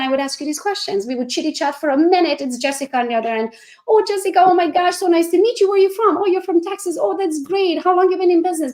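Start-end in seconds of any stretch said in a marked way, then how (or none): 3.9-8.7 high, bubbly small talk; chirpy overfriendly hello to a new recruit; gushing chatty greeting. Pretend. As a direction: gush over her like an old friend. Acting chatty call with Jessica.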